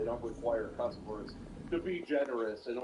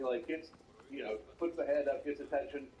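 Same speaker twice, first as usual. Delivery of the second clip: ordinary talk, nothing marked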